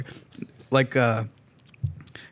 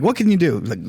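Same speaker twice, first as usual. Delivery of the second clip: ordinary delivery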